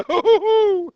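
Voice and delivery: high, loud manic laugh, then a slow drawn-out word; Mad Hatter cackle. Acted. Teacher ends the Mad Hatter bit for the class with a manic cackle. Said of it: "that was my Mad Hatter impersonation".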